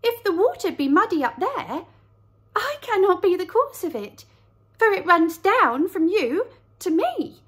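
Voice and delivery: high, earnest little lamb; a small high lamb protesting. Acted. Lamb answers.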